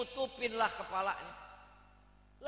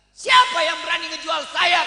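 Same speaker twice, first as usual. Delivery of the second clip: loud angry royal demand; furious king; 5 semitones higher, 13 dB louder than usual. Acted. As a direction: bellow it as an outraged king. King angrily demands who sold him. (raja marah berteriak)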